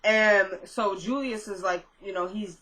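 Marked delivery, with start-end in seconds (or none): none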